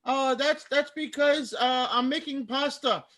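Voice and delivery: high, put-on German accent; bad German accent. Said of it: "My bad German accent".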